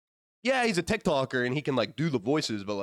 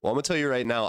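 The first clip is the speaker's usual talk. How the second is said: normal talking voice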